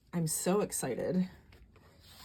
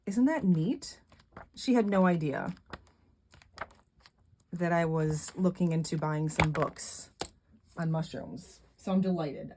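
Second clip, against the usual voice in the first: normal talking voice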